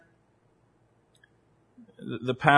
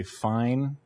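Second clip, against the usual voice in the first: imitating a wife's softly loaded "fine"; 7 dB quieter than usual. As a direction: say "Fine" softly, meaning otherwise. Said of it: "the way that word is intoned".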